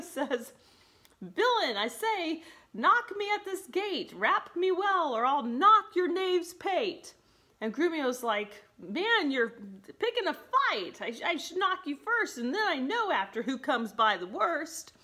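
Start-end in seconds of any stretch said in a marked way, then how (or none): 1.2-7.1 high-pitched mock threat, swooping; hammed-up huffy master, slowing on the last threat; huffier and huffier. Petruchio threatening his servant Grumio, mock-huffy. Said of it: "Petruchio's getting, like, huffier and huffier". 8.8-14.9 high, swooping aggrieved retort; hammed-up objection from a put-upon servant; mock protest. Grumio telling his master he's picking a fight, mock-aggrieved.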